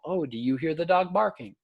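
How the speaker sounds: encouraging parent. Shown as a demonstration. Lecturer recalling the dog example in parent voice. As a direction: repeat the child's words back kindly. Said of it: "The dog bark example".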